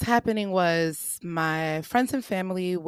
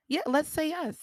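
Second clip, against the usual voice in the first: coaxing insistence; 9 semitones higher than usual. Retold or imitated. Counselor repeats suggestion after her surprise.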